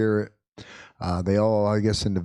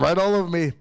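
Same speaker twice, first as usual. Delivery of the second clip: ordinary delivery, nothing marked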